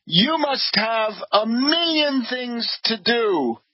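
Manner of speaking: slow quoted line. Retold or imitated. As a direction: read the hosts' polite brush-off slowly. Quoting the hosts' brush-off.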